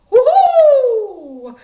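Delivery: high, loud owl hoot in head voice; head voice demo for children; woohoo head voice. Shown as a demonstration. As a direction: float up into a hooting owl sound. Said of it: "Try that with me".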